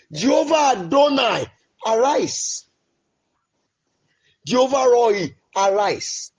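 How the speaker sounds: usual speaking voice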